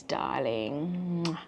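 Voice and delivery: ordinary talk, nothing marked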